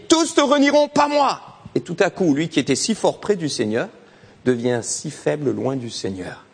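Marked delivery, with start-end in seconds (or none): 0.1-1.4 much higher proud vow; boastful Peter. Retold or imitated. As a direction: swear it like a proud disciple. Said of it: "cet homme qui avait dit".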